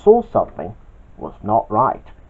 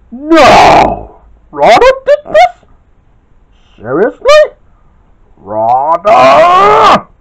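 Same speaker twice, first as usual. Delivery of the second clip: much higher and louder shocked shout; angry scream; 12 semitones higher, 10 dB louder, much slower than usual. Acted. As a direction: yell the culprit's name in disbelief. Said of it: "screamed Kid".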